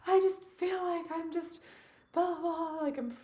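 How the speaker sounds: very high mock-tearful voice; high, hammed-up weepy complaint. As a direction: imitate yourself freaking out in tears. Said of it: "like, in tears".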